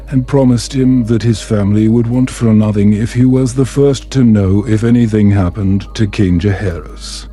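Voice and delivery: plain reading voice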